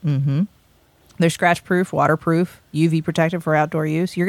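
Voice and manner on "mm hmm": ordinary talk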